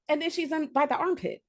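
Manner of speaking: usual speaking voice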